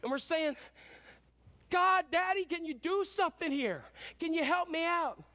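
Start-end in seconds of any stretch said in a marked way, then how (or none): 1.7-5.2 high-pitched plea to a father; pleading prayer. Acted. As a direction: beg God for help like a child calling Daddy. Voicing people in need praying.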